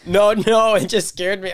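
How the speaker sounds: high, loud laughing reply. Real, not acted. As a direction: still shaken, laughing it off. Reacting to a startling sound.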